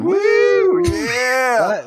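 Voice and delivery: high, loud partying whoop. Pretend. Mock partying with Excedrin.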